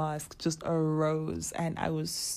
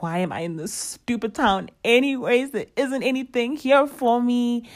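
angry; 6 semitones higher, 4 dB louder than usual. Retold or imitated. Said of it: "I felt angry".

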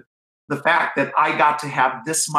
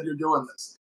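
a hint of a quiet Godfather voice, much softer; Marlon Brando; 7 dB quieter than usual. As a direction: lightly imitate Brando, softly. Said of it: "like Marlon Brando in The Godfather".

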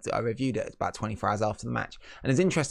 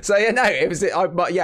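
laughing; 7 semitones higher, 6 dB louder than usual. Real, not acted. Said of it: "it's not that kind of Discord".

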